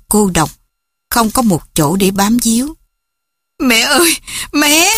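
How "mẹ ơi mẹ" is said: high-pitched cry for mother; screaming. A narrator's reading. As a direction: cry out helplessly, pitch climbing. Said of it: "Hồng hét".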